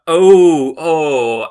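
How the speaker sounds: sudden loud oh of near success; surprised oh. Real, not acted. Learner realizing the guess was close, sudden loud oh. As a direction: blurt it out, caught close. Reacts to nearly right guess.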